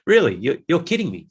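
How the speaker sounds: sudden loud, high disbelief; mock disbelief. Answering a busy excuse, loud incredulous really. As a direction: pretend you can't believe it. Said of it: "I'm like".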